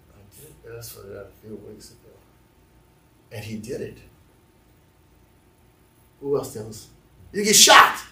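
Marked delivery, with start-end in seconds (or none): 0.1-4.0 hushed, barely audible confession; acted furtive near-whisper; secretive. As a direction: mutter it very low and soft, to yourself. Secretly admitting an answered prayer.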